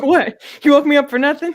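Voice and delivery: high, loud cat voice; mock outrage, pitched high and loud; indignant cat. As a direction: imitate an affronted, just-woken cat. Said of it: "She's like".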